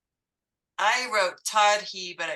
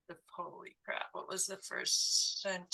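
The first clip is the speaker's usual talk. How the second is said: whispered; 10 dB quieter than usual. Real, not acted. Student flustered, voice drops away.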